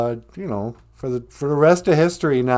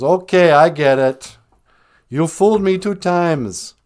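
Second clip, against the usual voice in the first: yelling; 5 semitones higher, 6 dB louder than usual. Acted. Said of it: "he's yelling at the, the tree".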